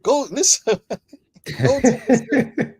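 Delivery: words broken up by real laughter; laughing through words. Real, not acted. Laughs at his own advice.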